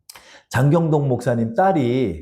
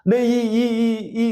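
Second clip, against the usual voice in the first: normal talking voice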